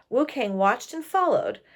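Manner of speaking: ordinary delivery, nothing marked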